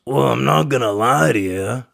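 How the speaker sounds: lightly voiced old man being candid. Barber imitating old man admitting his past.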